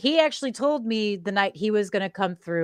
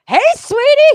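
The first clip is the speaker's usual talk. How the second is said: loud, high-pitched greeting to startle; mock sweet call-out; 12 dB louder than usual. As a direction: pounce with a piercing hello. Teller re-enacts surprising her friend.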